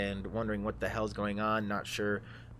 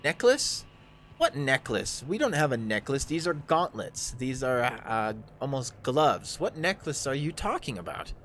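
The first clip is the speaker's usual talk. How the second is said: incredulous drow, pitched higher; baffled drow; 4 semitones higher, 3 dB louder than usual. Acted. Drow answers talk of a necklace, baffled and higher. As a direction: question it in high-lifting disbelief. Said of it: "and he goes".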